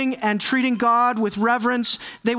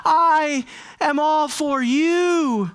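high, drawn-out show of devotion; acted earnestness; 6 semitones higher than usual. Worshippers protesting loyalty to God, over-earnest. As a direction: plead your earnestness, stretching the words out. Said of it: "how earnest I am with you".

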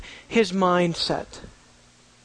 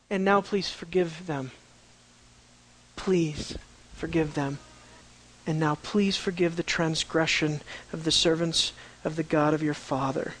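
soft plea; 5 semitones lower, 8 dB quieter than usual. Acted. Plea for forgiveness, softer.

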